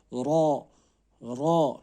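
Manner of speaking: را drawn out loud and full; teacher modelling a stretched را; stretched letter demo. Shown as a demonstration. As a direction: hold the را, give it length. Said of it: "وہ تھوڑا سا کھینچنا ہے".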